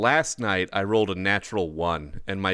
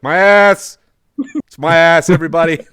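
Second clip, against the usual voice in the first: yelled out, triumphant; loud shout; 9 semitones higher, 13 dB louder than usual. Retold or imitated. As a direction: shout it to the whole room. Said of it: "and he was like".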